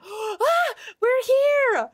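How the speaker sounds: high, thrilled squeal; gasping, high-pitched excitement; excited cry. Real, not acted. Arrives in the new game area.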